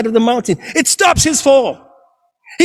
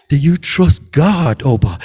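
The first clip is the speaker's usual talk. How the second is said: voice of God; 10 semitones lower than usual. Acted. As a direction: speak calm and low as God. God questions the climber.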